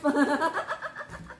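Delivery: high laugh trailing on after a quip; amused cackle. Real, not acted. Tarot reader laughing after hinting to viewers, amused cackle. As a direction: laugh at your own sly remark.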